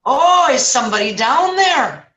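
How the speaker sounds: high, loud sing-out to someone below; passerby calling down. Acted. Passerby calls down to the man in the hole, acted call. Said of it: "he hears somebody say".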